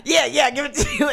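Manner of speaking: high, excited mock shout; hammed-up Hitler. Redoing the Hitler line with elbows, hammed-up high shout. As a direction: play it big, as if elbows are pumping. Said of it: "I wanna do my elbows with that".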